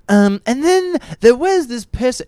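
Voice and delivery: high, loud mock British newsreader; mock British accent. Friend mocking co-host's reading in a high British accent. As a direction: ham up a British announcer reading the news. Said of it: "your British accent's spot on".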